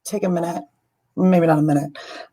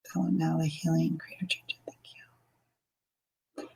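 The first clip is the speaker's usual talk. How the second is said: fading into a breathy whisper; whispered intention; 2 semitones lower, 13 dB quieter, slower than usual. Acted. Healer whispering a sacred intention over the prayer, fading away. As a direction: let the blessing trail off into a whisper.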